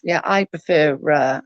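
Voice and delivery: ordinary delivery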